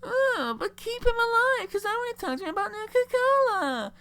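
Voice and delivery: very high, whiny falsetto; mock her as a squeaky, pleading whiner; Sierra. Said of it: "whiny thing that she is, goes".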